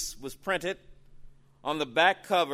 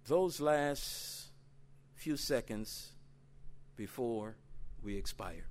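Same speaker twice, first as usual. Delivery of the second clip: solemn quiet after the screams; hushed reflection; 2 semitones lower, 10 dB quieter than usual. Real, not acted. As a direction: let it fall to near silence.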